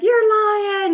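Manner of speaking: high, shocked squeal; disbelief. Retold or imitated. Hearing from husband about orders, in high shocked disbelief. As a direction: sound stunned by good news.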